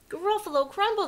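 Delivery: high, loud alarmed repeat; panicked storybook monster; horrified echo. Acted. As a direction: blurt it back in fright. Gruffalo repeats it in horror.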